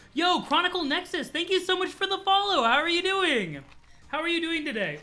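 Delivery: loud, high, eager thanks to a new follower; excited shout-out. Real, not acted. Streamer loudly thanking a new follower, excited. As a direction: greet them with a big bright shout.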